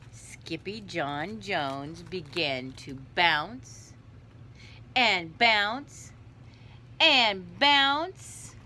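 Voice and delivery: slow read-aloud with building bounces; story narration. A narrator's reading. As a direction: draw it out for young listeners, lifting each bounce.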